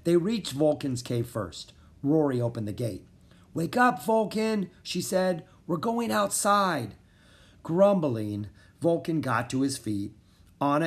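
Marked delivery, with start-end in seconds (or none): 3.5-7.0 lightly voiced girl. Waking a sleeping dragon.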